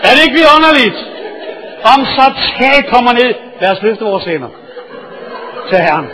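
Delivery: loud, high, driving sermon storytelling; full-force preaching. Acted. Preacher thrills his congregation with the roof story, loud and high.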